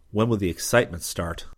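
ordinary delivery, nothing marked

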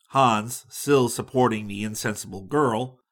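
ordinary delivery

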